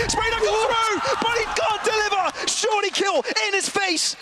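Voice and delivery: high, excited play-by-play; esports caster. Acted. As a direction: call the clutch like it's the final. Caster calls a comeback round.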